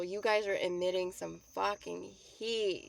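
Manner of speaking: ordinary talk, nothing marked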